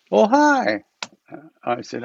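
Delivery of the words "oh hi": imitating Rinpoche. Rinpoche greeting, voiced high.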